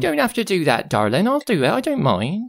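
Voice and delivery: high polite voice. Acted. Housekeeper refuses offer politely.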